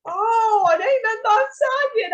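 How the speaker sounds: loud, high mock-crying wail; imitated sobbing. Granddaughter correcting grandma on the phone, loud imitated sobbing. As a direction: wail it like a retold phone meltdown. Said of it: "I was literally sobbing on the phone".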